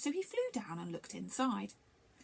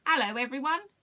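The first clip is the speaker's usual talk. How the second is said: higher, louder chirped greeting; chirpy little bird; 4 semitones higher, 6 dB louder than usual. A narrator's reading. Little bird greeting everyone on arrival, chirpy. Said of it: "chirped Duffy".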